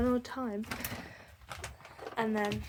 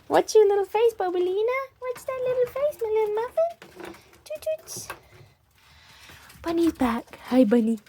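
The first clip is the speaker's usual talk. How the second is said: doting pet voice; 11 semitones higher, 10 dB louder than usual. Real, not acted. Owner calling her ferrets to the salmon, high sing-song baby talk. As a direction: coo over the ferrets like babies.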